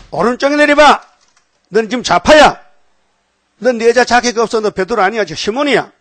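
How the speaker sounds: loud, high, forceful reprimand; preacher's shouted scolding as Jesus; acted rebuke. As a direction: raise your voice and order him sharply.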